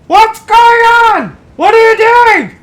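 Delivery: put-on a confused old woman calling from the other room; old mother calling out. Mother calling from bed, high confused old lady voice. Mom voiced far away.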